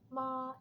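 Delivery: "ma" high and level; first tone. Shown as a demonstration.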